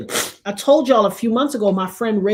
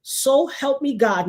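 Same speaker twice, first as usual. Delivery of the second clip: ordinary talk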